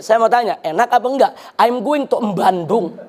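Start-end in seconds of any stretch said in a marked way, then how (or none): 1.6-3.0 English in a slow, heavy Javanese accent; mock Javanese intonation on slow, lower English. Shown as a demonstration. Preacher showing English that sounds wrong, mock Javanese accent. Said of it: "ada yang baca begini".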